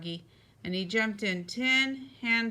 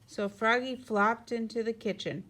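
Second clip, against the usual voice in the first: ordinary delivery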